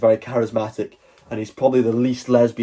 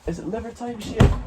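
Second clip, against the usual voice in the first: high dog voice; 12 semitones higher than usual. Real, not acted. Talking to the dog.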